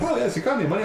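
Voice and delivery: very high cartoon Italian; Mario-style Italian accent. Locals chatting back to a visitor, high mock Italian accent. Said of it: "made it sound like Mario Brothers".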